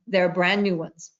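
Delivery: normal talking voice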